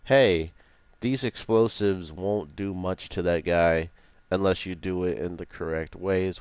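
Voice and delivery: ordinary talk, nothing marked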